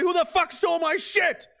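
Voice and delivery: high, loud furious yell; mad. Retold or imitated. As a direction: bellow it like someone just robbed you. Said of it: "You'd be mad".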